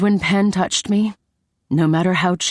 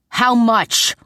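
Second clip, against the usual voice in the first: higher-pitched angry demand; exploding; 6 semitones higher, 3 dB louder than usual. A narrator's reading. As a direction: burst out at him. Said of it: "I exploded".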